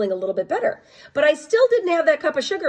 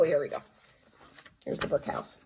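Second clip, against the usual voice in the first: plain reading voice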